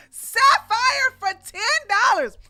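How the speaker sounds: donor shout-out. Real, not acted. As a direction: belt out the donor's name. Announces a donor.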